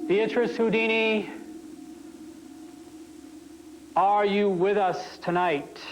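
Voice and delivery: talking to a ghost. Acted. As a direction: call out to the spirit beyond. Medium summons spirit at seance.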